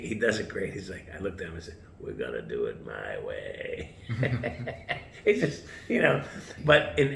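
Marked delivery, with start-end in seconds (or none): 2.0-4.0 low, slow and stubborn, lightly played; defiant. Defying the head chef. 4.0-5.2 laugh. Real, not acted. Laughs at his own stubbornness.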